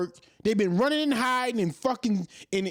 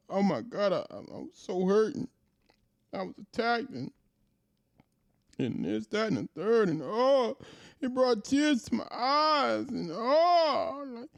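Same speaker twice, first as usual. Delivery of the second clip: mock crying. Commentator mocking a man who cried on CNN, mock crying. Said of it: "when what's his name cried on".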